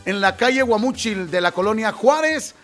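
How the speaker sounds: upbeat taco spot; friendly commercial read; radio ad read. Acted. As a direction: pitch the tacos to listeners. Reads taco shop ad.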